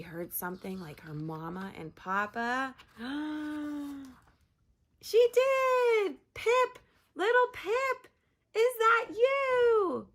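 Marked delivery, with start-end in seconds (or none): 2.8-4.1 surprised gasp. Acted. Parents hear Pip. 5.0-10.1 high, loud, joyful shouts; excited calling. Acted. Parents shouting for Pip, high and joyful.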